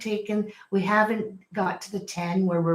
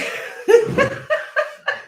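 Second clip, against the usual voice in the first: relieved laugh; 5 dB louder than usual. Real, not acted. Relieved after fetching the cord.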